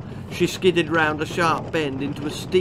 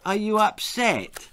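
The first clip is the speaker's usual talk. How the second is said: higher, timid question; nervous; 7 semitones higher than usual. Acted. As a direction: ask it nervously. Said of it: "she asked nervously".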